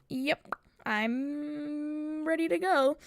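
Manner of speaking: drawn-out readiness. Retold or imitated. Student to herself as finals end, drawn-out readiness. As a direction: stretch it out slowly, acting ready to leave. Acts out being eager to leave.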